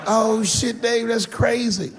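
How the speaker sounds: buddies impressed. Retold or imitated. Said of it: "they're all like".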